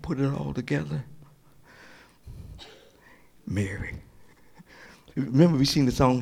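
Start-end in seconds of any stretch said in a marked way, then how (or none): 3.5-3.9 very soft hushed name; tender call. Acted. Jesus tenderly calling Mary. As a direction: breathe the name gently.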